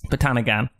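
ordinary delivery

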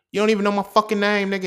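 angry yell. Retold or imitated. Yelling at the man on the phone.

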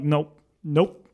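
repeated mock-stubborn refusal, pitch jumping up. Sleeper refusing to look at ghosts, stubborn. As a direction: shut it down in quick little bursts.

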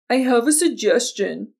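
mocking echo of a bossy castmate; exaggerated mimicry of someone else's suggestion; mimicking her tone. A narrator's reading. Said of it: "mimicking Fawn's tone".

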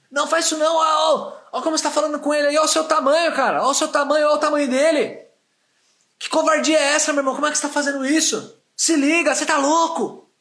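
high, loud, fast outraged yelling; angry stranger yelling at a man over his dog. Retold or imitated. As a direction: berate the dog owner in outrage. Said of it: "começou a berrar comigo".

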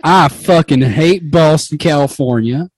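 slow loud cowboy Texan twang; Texas accent. Acted. Roger Clemens as a mock Texan, hammed-up drawl. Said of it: "Roger Clemens is from, like, Texas".

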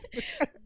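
éclat de rire aigu et bref. Real, not acted. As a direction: rire franchement de la blague. Éclat de rire après la taquinerie. (petit rire aigu amusé)

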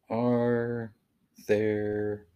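word by word, long pauses between; typing aloud. Real, not acted. Co-host looking up bears in Ohio, halting word-by-word typing voice. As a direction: draw each word out as you type it.

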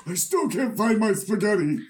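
high put-on caricature voice; Fox News. Friend echoing the Fox News impression, high caricature. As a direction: whine it in a high, silly voice. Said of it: "They're gonna be like".

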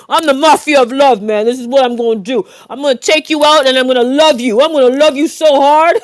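mafia character voice. Pretend. Playing the mafia of love on request, mobster voice. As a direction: ham it up as a loving mobster. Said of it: "Have a voice for that guy".

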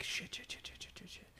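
rapid unvoiced muttering, imitated; panicked whisper. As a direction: whisper it in dread under your breath. Recruits panicking under their breath.

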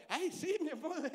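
sugary high mock "sí, mi amor"; coy play-along. Woman sweetly playing along with the man, coy.

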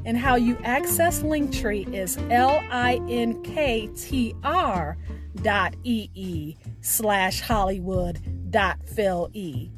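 ordinary delivery

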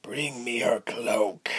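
slow, breathy coarse command; drawn-out rough villain voice; villain's order. A narrator's reading. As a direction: order it without hurry, half-whispered. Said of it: "he ordered".

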